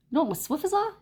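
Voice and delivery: high-pitched mock New York accent; tough-guy accent. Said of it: "He talks like this".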